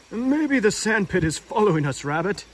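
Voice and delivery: gentle Pooh voice; lightly voiced Pooh. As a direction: wonder aloud innocently.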